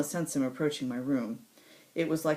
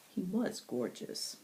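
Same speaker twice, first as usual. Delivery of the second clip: plain reading voice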